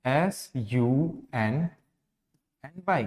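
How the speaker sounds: slow spelling, split between the double letters; spelled out, divided. Shown as a demonstration. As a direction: spell slowly, pausing where the word divides. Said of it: "divide करेंगे तो कैसे होगा". (रुक-रुककर, तोड़कर spelling)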